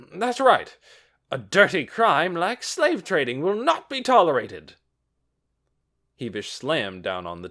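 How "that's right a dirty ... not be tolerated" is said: loud, high indignation; loud indignant official. A narrator's reading. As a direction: bang the table and declare. Official backs him up.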